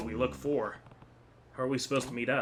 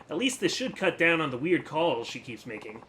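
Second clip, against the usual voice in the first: ordinary talk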